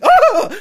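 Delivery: loud, very high mock shriek; piercing imitation scream; imitated scream. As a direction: shriek out her fright, hammed up. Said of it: "She's like, she screams".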